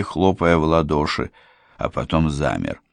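usual speaking voice

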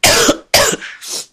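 harsh chesty cough; coughing fit. Real, not acted. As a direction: cough through a sore chest. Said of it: "estou cheia de tosse".

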